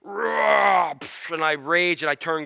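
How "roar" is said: mock erupting roar; drawn-out roar bursting into an explosion noise; volcano roar. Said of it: "It's like the volcano".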